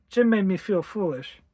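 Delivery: ordinary delivery, nothing marked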